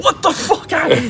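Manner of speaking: high, slow, stunned outcry; retold disbelief. Fans arriving home to the loss, high stunned disbelief. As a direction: sound floored by the bad news. Finding out the Jets lost.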